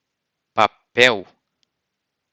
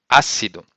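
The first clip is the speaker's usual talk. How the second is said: ácido with the weight on the opening á; stress on first syllable; 7 dB louder, faster than usual. Shown as a demonstration. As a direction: lean on the á of ácido, drop the rest. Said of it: "The same case is the word".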